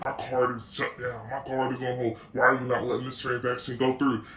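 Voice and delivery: low, demanding customer voice; gruff angry caller. Retold or imitated. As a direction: growl like an irate caller. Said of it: "They're like".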